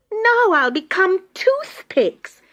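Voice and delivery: high, slow wailing little tree; drawn-out bitter lament. Acted. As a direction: wail it out in despair. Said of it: "wailed Mirinda bitterly".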